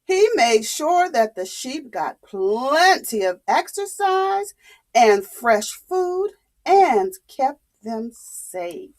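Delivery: ordinary delivery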